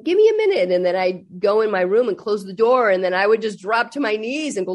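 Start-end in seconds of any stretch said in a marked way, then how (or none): 0.0-0.7 poker-faced reply. Retold or imitated. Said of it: "do that poker face and go".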